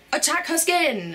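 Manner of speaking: high, slow mock villain cry. Beauty vlogger voicing elements attacking her skin, mock villain. Skin's enemies voiced in a story.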